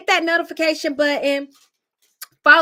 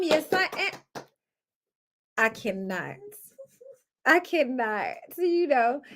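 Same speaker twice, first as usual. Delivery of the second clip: usual speaking voice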